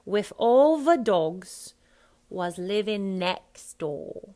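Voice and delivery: loud, slow "with" as wiff, "the" as va; Cockney f then v. Shown as a demonstration. Coach modelling the line, Cockney f then v. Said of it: "F and then V".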